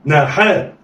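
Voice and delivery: "ناحاء" joined in one quick go; snatch "ناحاء" with no pause; snatched together. Shown as a demonstration. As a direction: blend "ناحاء" like one word. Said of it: "يلا، اخطف".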